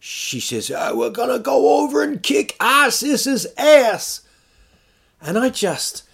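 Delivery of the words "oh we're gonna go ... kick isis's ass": high, loud rallying shout; hammed-up American political rally holler; Sarah Palin. Imitating her endorsing Trump, hammed-up high shout. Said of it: "and then she does this, she says".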